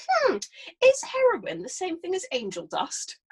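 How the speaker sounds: put-on a laughing take on a curious ten-year-old; imitating her son. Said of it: "Oliver, age ten, pipes up and goes".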